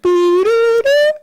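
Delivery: high, slow sung disconnect tones; imitated phone tones. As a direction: sing out the three-tone phone error. Phone call hits disconnected tone.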